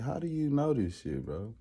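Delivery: muttering doubt to a friend; quietly asking a friend how he knows; skeptical aside. Retold or imitated. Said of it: "I always thought".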